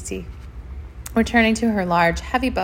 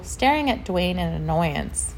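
plain reading voice

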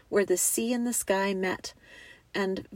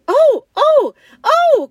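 high, very loud wailing; anguished cries; 14 dB louder, slower than usual. A narrator's reading. Two girls rushing to the empty table, anguished cries. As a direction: cry out in grief, each one loud. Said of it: "cried the two girls".

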